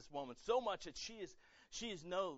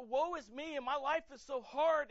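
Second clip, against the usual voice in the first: higher, louder, slow exaggerated whine; mock self-pity; 11 semitones higher, 8 dB louder than usual. Self-focused believer moaning about life, hammed-up whine. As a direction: ham up the woe-is-me moan. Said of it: "and I'm".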